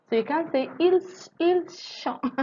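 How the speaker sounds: il chante voiced high, trying to force a link; mock impossible liaison. Shown as a demonstration. Teacher jokes about a wrong liaison, high and playful. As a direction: attempt a liaison that cannot happen. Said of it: "I don't know how to try that".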